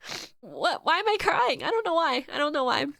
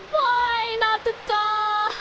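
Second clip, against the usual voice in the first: slow, higher, tearful plea; crying; 6 semitones higher, much slower than usual. Real, not acted. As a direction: drag it out through tears. Sobbing over the dog.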